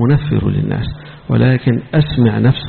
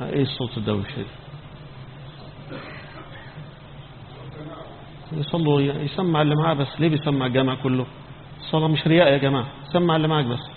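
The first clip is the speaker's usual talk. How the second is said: hushed aside; 11 dB quieter, faster than usual. Real, not acted. Preacher muttering aside to the congregation about the noise, hushed. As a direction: drop the voice for a quick side remark.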